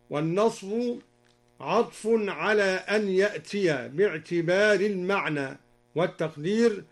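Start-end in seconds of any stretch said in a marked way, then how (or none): none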